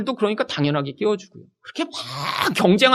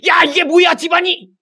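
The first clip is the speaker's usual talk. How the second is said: sudden high angry shout at home; a husband exploding at home after bowing to the boss; yelling at family; 10 semitones higher, 4 dB louder than usual. Retold or imitated. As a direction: blow up at the family, loud and high. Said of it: "이렇게 소리 지르는 사람들".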